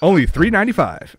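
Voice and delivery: announcer voice fighting off a laugh; parody ad read. Acted. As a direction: sell the candy like a cheesy commercial. Ad price tag line.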